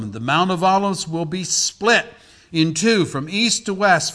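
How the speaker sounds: loud, high proclamation of prophecy; preaching scripture. Acted. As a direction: build to a ringing cry, then drop at the end. Reads prophecy at full force.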